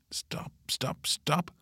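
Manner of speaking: whispered, hurried stop; hushed protest. A narrator's reading. Mille halting Pip's gushing about Otto, hushed protest. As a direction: cut them off under your breath. Friend protests at kissing talk.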